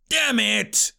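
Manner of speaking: a touch of vexed exclamation; frustrated outburst. A narrator's reading. Greg loses the brat, frustrated outburst. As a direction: blurt it out in frustration.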